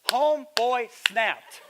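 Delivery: slow, loud, high punchline; emphatic drawn-out telling. Retold or imitated. Preacher telling how the driver lost it, slow emphatic delivery. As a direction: stretch each word for dramatic weight. Emphatic retelling of man's rage.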